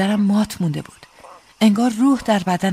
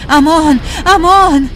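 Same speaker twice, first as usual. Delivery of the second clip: loud, high cry for mercy; pained cry; 8 semitones higher, 10 dB louder, slower than usual. A narrator's reading. Soldier with his wrist twisted begging for mercy, pained cry. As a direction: cry out in pain, begging for quarter. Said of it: "از درد ناله کرد و فریاد کشید".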